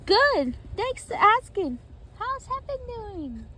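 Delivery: high, chirpy reply; high-pitched, loud at first; listener character's voice. Acted. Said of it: "And you said".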